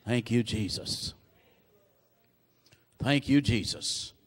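very low, soft thanks; murmured prayer of thanks, far below preaching level; hushed praise. Real, not acted. Private praise after the blessing.

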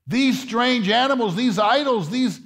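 high, rising, fervent preacher's call; impassioned pulpit preaching; preaching at full force. Acted. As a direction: lift it and drive it home to the congregation.

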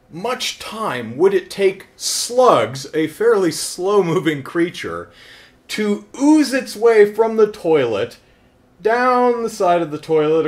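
high, prim, pondering tone; fussy wondering voice. Retold or imitated. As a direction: wonder aloud like a prim scholar. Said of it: "I thought to myself".

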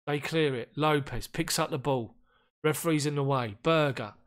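normal talking voice

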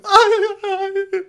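very high, loud anguished wails; mock dramatic cries. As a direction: wail like a tortured artist in a hallway. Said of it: "walks down the corridor going".

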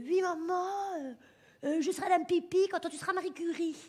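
hammed-up son answering his mother; affected son. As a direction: imitate the snooty son. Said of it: "Il se donne un petit air".

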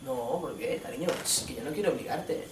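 put-on quiet, wounded boyfriend reassuring; soft sulky boyfriend. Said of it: "Estás enfadado". (novio dolido hablando bajito)

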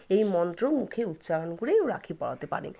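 ordinary talk

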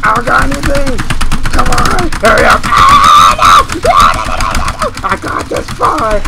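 real loud frantic shouting; loud desperate no no no; panicked yelling. Real, not acted. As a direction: scream at the screen in panic. Panicked under attack in game.

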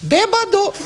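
very high squeaky taunting call of bêbado; shrill-pitched mocking snake calling out. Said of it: "looked at him and says".

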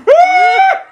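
high sudden scream; startled scream. Retold or imitated. Imagining her reaction to shocking news, screaming. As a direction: let out a sharp shocked scream. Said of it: "'Cause I'd be like".

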